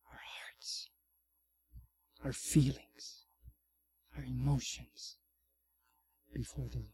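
soft, low sermon close with long pauses; gentle pastoral exhortation. Real, not acted. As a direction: quietly encourage a hurting congregation.

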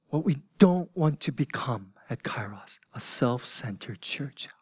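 hushed, breathy whisper to the end of the quote; whispered warning. Acted. Preacher to his congregation about becoming self-centered, whispering.

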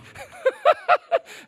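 high, loud burst of laughter; real laugh. Real, not acted. Laughs at own pettiness.

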